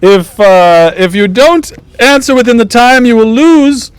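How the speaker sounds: high-pitched court jester master of ceremonies; theatrical jester, far above normal pitch; jester announcing contest. Acted. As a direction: announce the rules in a piping jester voice.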